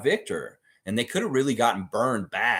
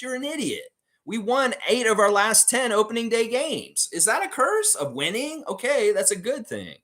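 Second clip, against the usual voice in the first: heated rant; 10 semitones higher than usual. Real, not acted. Streamer scolding a chat fan about the curse. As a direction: set the fan straight, voice jumping high.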